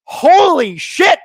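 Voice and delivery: high, slow disbelieving holy shit; real disbelief at the dive; stunned outburst. Real, not acted. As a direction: drag it out in shock. Reacting to a dive.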